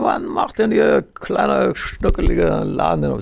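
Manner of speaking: totally plastered caller slurring into the phone; very drunk caller. Retold or imitated. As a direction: stretch it out like a man completely wasted. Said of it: "ist schon völlig hinüber".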